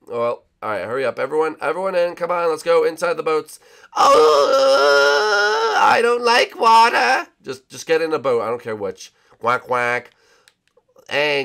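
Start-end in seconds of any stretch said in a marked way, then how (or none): 3.9-7.3 high, slow fearful wail; scared whine. Acted. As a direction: whimper like a frightened cartoon.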